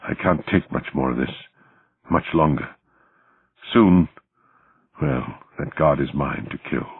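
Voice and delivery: understated beaten man, low and subdued; through swollen lips. A narrator's reading. Beaten Targov vowing to kill the guard, through swollen lips. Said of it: "through swollen lips".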